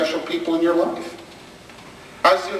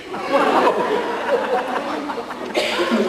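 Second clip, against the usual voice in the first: high burst of laughter; 9 semitones higher than usual. Real, not acted. Laugh at kid's answer.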